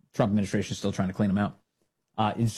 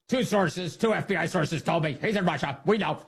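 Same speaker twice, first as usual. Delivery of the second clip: mock reporter; 7 semitones higher than usual. Host acting out the reporter.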